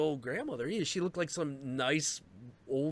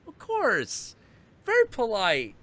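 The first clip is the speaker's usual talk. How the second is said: put-on high, louder prim old lady; 10 semitones higher, 6 dB louder than usual. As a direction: chirp it like a proper suburban grandma. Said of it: "She's like".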